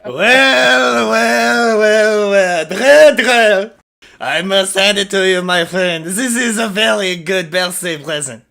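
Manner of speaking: gleeful gloating. Acted. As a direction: savor the turned tables. Character gloats over the captured villain.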